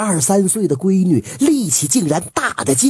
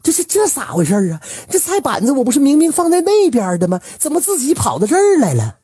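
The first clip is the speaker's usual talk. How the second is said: fast, much higher alarmed exclaiming; startled cry; 9 semitones higher, faster than usual. A narrator's reading. Wife finds the chopping board moved, startled fast cry. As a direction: blurt it out in shocked disbelief. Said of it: "当时就叫起来了".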